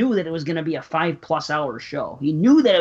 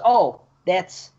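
drawn-out slow recognition; slow knowing quote; much slower than usual. Retold or imitated. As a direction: stretch it out slowly. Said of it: "She goes".

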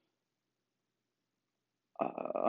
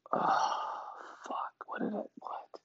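whispered, barely audible dismay; exasperated whisper; 12 dB quieter than usual. Pretend. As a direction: mutter it under your breath in disbelief.

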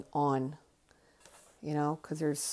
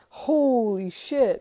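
higher, louder, slow stunned exclaim; imitated astonishment; 8 semitones higher, 8 dB louder, much slower than usual. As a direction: play the aunt floored by the news. Aunt hears about [name].

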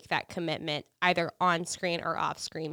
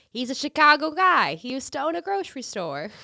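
a deliberately bad Chicago accent, higher and louder at first; horrible Chicago accent; 6 semitones higher, 4 dB louder than usual. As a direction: ham up a clumsy Chicago accent. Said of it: "That's my really horrible Chicago accent".

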